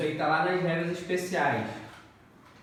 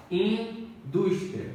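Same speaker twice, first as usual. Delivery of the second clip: Indústria stretched out slowly, weight on -dús-; slow stressed demo; 3 semitones higher, much slower than usual. Shown as a demonstration. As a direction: drag Indústria out, leaning on -dús-. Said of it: "Penúltima sílaba é tônica". (Indústria bem lento, com ênfase em dús)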